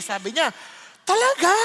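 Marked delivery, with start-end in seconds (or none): 1.1-1.5 high, loud astonished "really?"; a woman stunned by the answer; amazed question. Retold or imitated. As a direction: gasp it out in disbelief.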